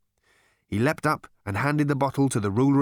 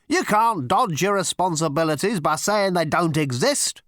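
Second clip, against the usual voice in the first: high, emphatic scolding; indignant, frustrated outburst; exasperated cry; 7 semitones higher, 4 dB louder than usual. A narrator's reading. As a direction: berate him at raised pitch. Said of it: "cried Zarniwoop".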